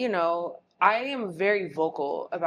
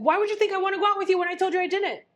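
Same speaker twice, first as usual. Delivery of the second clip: exasperated retort; 11 semitones higher, 4 dB louder, faster than usual. Retold or imitated. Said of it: "So I was like".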